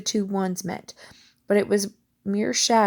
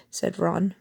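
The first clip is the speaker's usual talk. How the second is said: ordinary delivery, nothing marked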